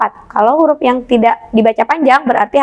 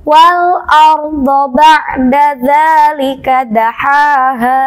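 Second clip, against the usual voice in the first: slow, drawn-out chanted recitation, louder; Quran recitation; 3 dB louder, much slower than usual. Shown as a demonstration. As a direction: recite each syllable slowly and clearly. Teacher recites the example verse. (lantunan bacaan Quran yang lambat dan keras)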